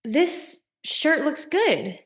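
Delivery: statement with a questioning undertone, pitched high; high, tentative hint for reassurance; fishing for approval. Retold or imitated. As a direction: say it slow and high, angling for validation. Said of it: "tell by the way that she's saying it".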